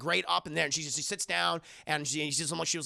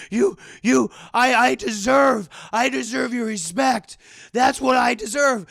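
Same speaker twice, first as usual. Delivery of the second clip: loud, raised, wounded ranting; acted outrage; 4 semitones higher, 8 dB louder than usual. Captor blocks the exit, ranting in loud acted outrage. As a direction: demand respect in a rising yell.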